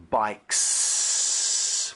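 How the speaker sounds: bikes with a long hissing s at the end, said slowly; long unvoiced final s. Shown as a demonstration. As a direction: let the s of bikes hiss out gently. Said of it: "would be unvoiced".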